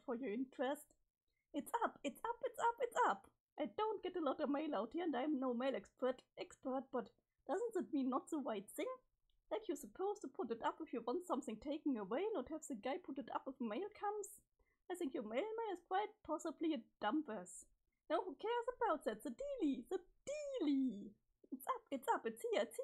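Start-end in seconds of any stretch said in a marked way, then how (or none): 1.5-3.3 high-pitched, breathless-paced repeated exclamation; can't contain it, pitched way up; excited outburst. Acted. Acted excitement at mail flag. 18.1-21.1 worked-up squeal of impatience; excited insistence. Acted. Impatient, excited about mailbox flag.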